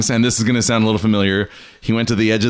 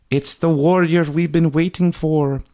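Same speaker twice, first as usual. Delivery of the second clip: gasp, then high reverent awe; mock amazed announcement, pitched up; hammed-up breathless wonder after a gasp; awed clerics; 7 semitones higher than usual. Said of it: "the clerics were like".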